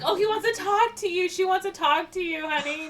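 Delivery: high, coaxing hammed-up sweetness; mock doting mother. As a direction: hand over the phone with fake tenderness. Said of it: "and she's like".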